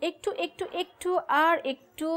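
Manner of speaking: high, drawn-out coaxing; coaxing for patience. Real, not acted. Asking impatient partner to wait.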